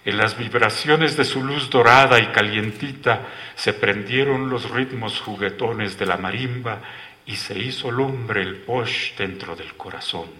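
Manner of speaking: plain reading voice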